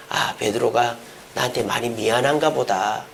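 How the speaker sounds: low, soft musing aside; quiet musing. Acted. Jesus to himself about apologetic Peter, quiet musing. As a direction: think it over softly, low-voiced.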